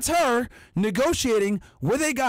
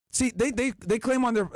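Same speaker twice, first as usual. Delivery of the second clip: normal talking voice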